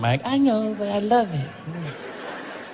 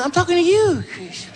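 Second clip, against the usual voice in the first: high, gentle Michael impression with a laugh; [name]; 5 semitones higher, 4 dB quieter, slower than usual. Michael renaming Chris as Christmas, high and sweet. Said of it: "I go".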